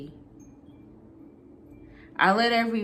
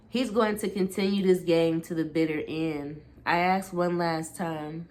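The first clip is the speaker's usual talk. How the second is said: ordinary delivery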